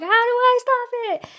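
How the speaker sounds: pleading with herself, pitched high; desperate plea. Retold or imitated. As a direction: cry out the question in desperation.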